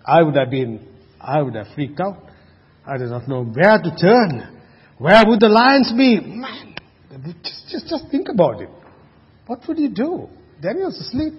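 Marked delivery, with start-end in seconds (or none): 6.2-11.3 high, hushed amazement; awed disbelief. Real, not acted. Preacher marvelling to congregation at Daniel's calm, awed and hushed. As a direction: marvel softly at sleeping Daniel. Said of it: "marvelling, voice high and soft".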